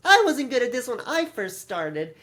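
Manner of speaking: high mock-preachy announcer; mocking Chris. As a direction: parody her bubbly host voice. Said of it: "she goes".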